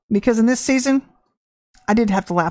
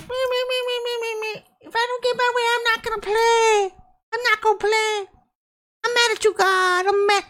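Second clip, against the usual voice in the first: much higher, whiny mock child throwing a fit; childish tantrum; 13 semitones higher than usual. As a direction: mimic a sulking teenager stamping her feet. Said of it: "acting like fifteen-year-olds. Stamping their feet".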